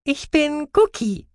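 very high, slow creature voice; squeaky, drawn-out and clear; mouse-beaver voice. Acted. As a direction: introduce yourself in a squeaky critter voice. Mouse-beaver introduces himself.

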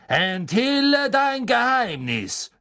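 loud, high, slow incantation; spell command. Acted. Snape commanding the map to reveal itself. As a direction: command the parchment.

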